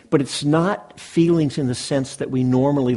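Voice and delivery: normal talking voice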